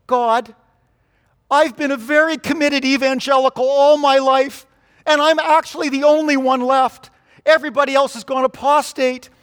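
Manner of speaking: high, loud, insistent protest; hammed-up prophet's indignant complaint; self-righteous Elijah complaining. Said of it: "here's what Elijah said".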